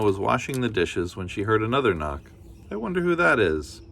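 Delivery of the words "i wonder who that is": much higher wondering voice; curious granny. Acted. Granny hearing a knock, high and curious. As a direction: wonder aloud brightly. Grandmother voice.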